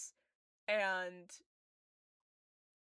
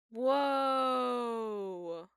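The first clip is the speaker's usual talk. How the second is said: awed whoa; 5 dB louder than usual. Real, not acted. Admiring how the picture looks.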